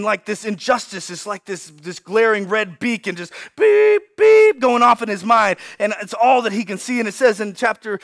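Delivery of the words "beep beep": high, loud electronic beep; imitated alarm beep. Injustice goes off in Moses' mind, high beeping. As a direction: beep like a warning beacon.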